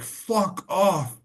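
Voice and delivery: high, sharp shouted brush-off; retold angry yell. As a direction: bark it at the guy offering mushrooms. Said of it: "I was, like, screaming".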